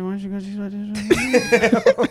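high, slow, wavering comic repeat; losing confidence. Acted. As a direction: repeat the demand as nerve drains away. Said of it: "my confidence level started going lower".

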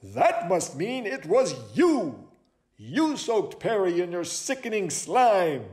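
high, emphatic detective voice; dramatic accusation. Acted. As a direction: lay the blame with drama. Owl accuses a suspect.

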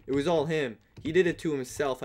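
normal talking voice

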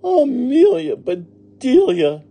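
high, loud, drawn-out exasperation; Missus Rogers exclaiming. A narrator's reading. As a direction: cry the name slowly in outrage. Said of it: "exclaimed Missus Rogers".

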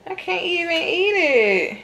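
high, slow whine; whining lament. Real, not acted. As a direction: moan over the ruined bagels. Lamenting burnt bagels.

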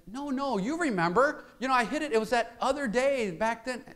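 put-on high, quick child's voice; eager boy. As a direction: play the hopeful little son. Boy insisting to father.

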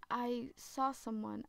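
usual speaking voice

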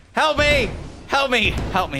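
shouting for help. Real, not acted. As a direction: yell it like you're trapped. Stuck, yelling for help.

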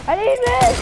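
loud and high, calling out; shouting for meds. Real, not acted. Player begging teammates for meds, shouting. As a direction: yell it to your teammates across the map.

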